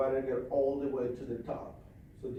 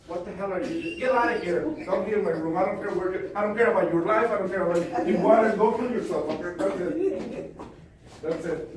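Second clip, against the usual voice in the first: loud, angry telling-off, pitched up; angry scolding; 6 semitones higher, 8 dB louder than usual. Real, not acted. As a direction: yell them out of the room. Scolding someone out of the room.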